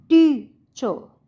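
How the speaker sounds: Teacher with टी loud and drawn out, चर dropping away; stress on first syllable. Shown as a demonstration. Teacher demonstrating word stress to students, टी stressed in teacher. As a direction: hit टी hard and long in teacher. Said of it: "ज़्यादा जोर लग रहा है".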